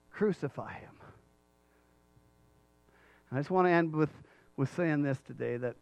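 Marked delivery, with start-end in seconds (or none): 0.1-0.9 low, soft, falling repeat; quiet echo. Acted. Preacher echoing the crowd's cry, quiet and low. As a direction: let the cry sink to a sombre hush.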